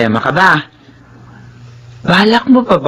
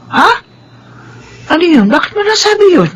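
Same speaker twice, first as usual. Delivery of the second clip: high, quick, startled questions; alarmed; 14 semitones higher than usual. Acted. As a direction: jump on the news in alarm. Housekeeper alarmed by news.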